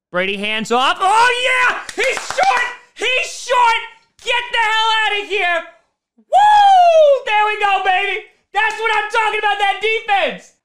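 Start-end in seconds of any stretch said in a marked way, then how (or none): 1.0-5.6 high-pitched celebratory yelling; real fan exploding with joy; excited shouting. Real, not acted. Celebrates a defensive stop live. 6.2-7.2 very high, loud sustained woo; long fan cheer; celebratory whoop. Real, not acted. Cheers the stop. 7.2-10.5 high-pitched, pumped-up fan yelling; excited cheering. Real, not acted. Celebrates the defense.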